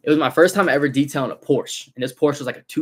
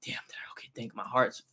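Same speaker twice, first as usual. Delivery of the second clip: breathless whisper of relief; relieved whisper; 10 dB quieter than usual. Retold or imitated. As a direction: whisper it like the danger just passed. Relief after no smoke.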